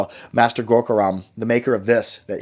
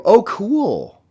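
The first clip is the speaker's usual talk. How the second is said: high oh cool, falling at the end; pleased, pitched up, landing down on cool; answering the viewer with a falling oh cool; excited reaction; 10 semitones higher, 6 dB louder than usual. Real, not acted. Second reaction to viewer comment.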